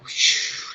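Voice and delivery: unvoiced rush of air; whoosh of release. Shown as a demonstration. Showing how venting feels, airy whoosh. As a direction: let it out like a deflating balloon. Imitates venting like deflating balloon.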